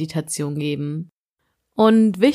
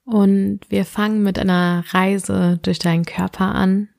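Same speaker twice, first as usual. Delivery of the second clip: sleep meditation; slower than usual. Acted. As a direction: guide the listener gently into sleep.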